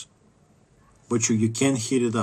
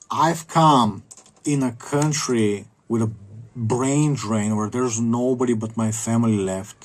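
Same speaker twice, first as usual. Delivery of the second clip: ordinary delivery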